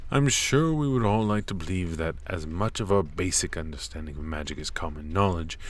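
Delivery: usual speaking voice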